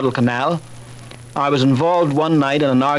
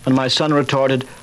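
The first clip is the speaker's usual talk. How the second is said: ordinary talk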